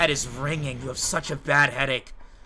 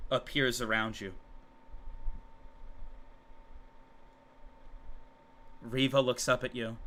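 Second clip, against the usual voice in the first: softer, lower dark narration; hushed narration; 3 semitones lower, 5 dB quieter than usual. A narrator's reading. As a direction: draw them in quietly. GM narrates shattering moment.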